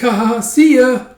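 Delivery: high, loud laughing elf goodbye; bubbly cartoon sidekick; cheery farewell. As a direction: chuckle and call out a squeaky farewell.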